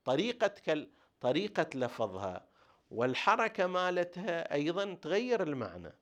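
normal talking voice

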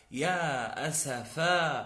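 "أسفا" drawn out as asafaa; slow demo of "أسفا" with open final aa; long final alif. Shown as a demonstration. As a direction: slowly stretch the last aa of "أسفا". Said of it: "هذه الألف".

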